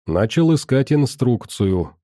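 usual speaking voice

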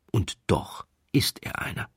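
hushed whisper revealing a secret; confiding stage whisper. A narrator's reading. Narrator reveals hidden truth.

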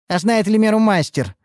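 high, pointed comeback; caustic retort. A narrator's reading. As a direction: throw the question back acidly. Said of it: "откликнулась повариха едко".

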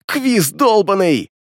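high, slow, raging yell; berserk rage. Acted. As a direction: drag it out in fury. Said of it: "в бешенстве заорал".

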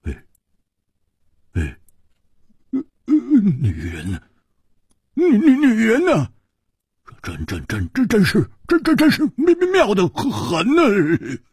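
muttering to himself. A narrator's reading. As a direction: stutter on first syllables, pitch leaping up. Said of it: "喃喃地说".

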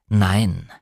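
low, firmer repeated denial; lightly voiced male character, emphatic; Frank. A narrator's reading. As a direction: insist on it, low and solid.

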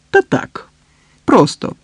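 high-pitched, evasive little answer; lightly coy deflection; coy, mysterious smile. A narrator's reading. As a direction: brush it off with a secretive smile. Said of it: "загадково посміхнулася".

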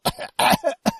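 hacking choked cough; harsh cough. Acted. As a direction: cough hard mid-sentence. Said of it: "кашляя".

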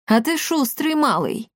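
high-pitched grudging remark, falling away; villain's mocking observation; taunting. A narrator's reading. Emperor taunts his nimble opponent.

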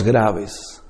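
deep voice. Shown as a demonstration. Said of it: "se asocia con los tonos".

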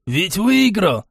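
high, drawn-out exclamation; excited disbelief. A narrator's reading. Rushes at Глайдер to confirm the win, excited. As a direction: press for confirmation, pitched high. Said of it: "подскочил он к Глайдеру".

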